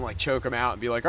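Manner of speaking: normal talking voice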